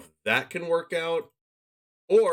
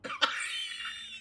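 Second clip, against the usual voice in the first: laugh; 8 dB quieter than usual. Real, not acted. Streamer cracks up at the bad outcome, laughing. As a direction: squeak breathlessly, then burst out laughing.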